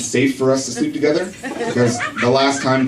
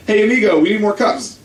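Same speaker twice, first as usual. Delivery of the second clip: coworker voice; 8 semitones higher than usual. Retold or imitated. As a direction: call out like a busy coworker. Coworker calling to him.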